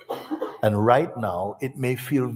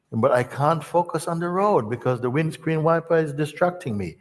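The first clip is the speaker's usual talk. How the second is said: higher, complaining protest; whining learner; 7 semitones higher than usual. Retold or imitated. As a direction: whine that the wiper distracts you.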